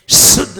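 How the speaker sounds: शुद्ध with a loud, long hiss at the front; शुद्ध, first sibilant held and forced; whistling sibilant. Shown as a demonstration. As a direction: push the s of शुद्ध until it whistles. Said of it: "जब सीटी नहीं बजती है".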